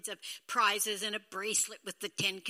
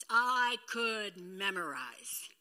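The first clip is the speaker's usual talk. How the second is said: slow, drawn-out I could memorize; slow emphatic; 3 semitones lower, much slower than usual. Shown as a demonstration. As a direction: stretch I could memorize out slowly. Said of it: "repeated slowly".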